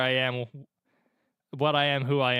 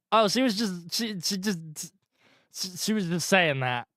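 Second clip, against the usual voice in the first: flustered stammer; 4 semitones higher, slower than usual. Real, not acted.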